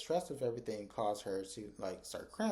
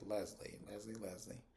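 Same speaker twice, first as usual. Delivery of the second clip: disapproving tut; 3 semitones lower, 11 dB quieter than usual. Real, not acted. Vlogger disapproving of Leslie.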